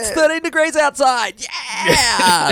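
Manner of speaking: high, hammed-up DJ patter; radio host voice. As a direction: overdo a cheesy radio announcer. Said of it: "affect what they think a radio voice is".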